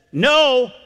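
NO held high and firm; emphatic no. Retold or imitated. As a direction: pitch NO up as a final verdict. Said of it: "some emphatic no".

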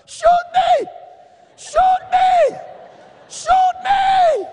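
very high, very loud defiant shout; full-voiced cry of shoot me at the gunmen. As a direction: yell it at the gunmen, daring them. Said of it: "I began to shout at him".

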